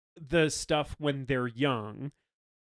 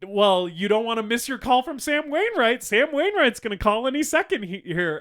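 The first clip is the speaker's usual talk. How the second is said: higher, louder pushy mother voice; nagging mom; 8 semitones higher, 6 dB louder than usual. Retold or imitated. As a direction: nag your daughter about the phone call. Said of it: "And then she's like".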